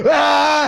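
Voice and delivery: high, startling scream; sudden mock scream. ASMR host waking everybody up after whispering, mock scream. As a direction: burst into a playful scream out of nowhere. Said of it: "all of a sudden just be like".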